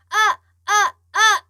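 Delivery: loud, high, throat-squeezed "ah!"; strangled voice. Shown as a demonstration. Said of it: "목을 조여서 내는 소리예요".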